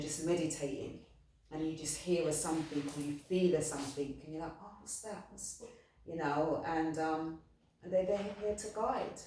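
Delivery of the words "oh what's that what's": much softer, a curious hushed question; hushed wonder. Retold or imitated. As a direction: drop right down as if catching a faint sound. Imagined reaction to a sign.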